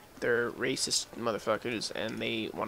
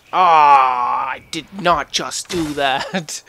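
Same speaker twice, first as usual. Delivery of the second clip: loud, slowed disbelief after a blunder; loud dismay after a botched jump; frustrated outburst; 14 dB louder, much slower than usual. Real, not acted. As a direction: groan it out as the mistake lands. Failed jump in game.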